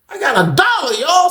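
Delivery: high excited outburst; sudden realization. Retold or imitated. Remembering his hundred dollars, a high excited blurt. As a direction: blurt it out like a light bulb just went on. Light bulb moment, remembering money.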